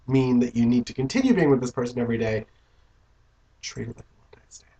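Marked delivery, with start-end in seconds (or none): 3.6-4.7 hushed unvoiced whisper. Real, not acted. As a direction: whisper it close. Whispered advice.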